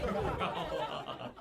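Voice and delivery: "Grow up" breathed out through a laugh; laughing repeat. Acted. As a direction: let "Grow up" dissolve into laughter. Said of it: "repeat through laughter".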